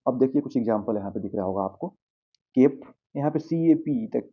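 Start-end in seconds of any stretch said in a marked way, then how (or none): none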